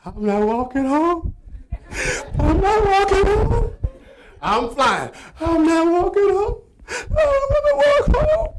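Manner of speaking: high, loud, tearful wailing; crying while running. Retold or imitated. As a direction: wail it through sobs, pushing on. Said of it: "And I'm still crying".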